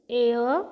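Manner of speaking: ए gliding into अ, "ay-uh"; vowel demo. Shown as a demonstration. Teacher recaps the sound of A, clear ए अ demo. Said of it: "एचा उच्चार काय पाहिला आपण?".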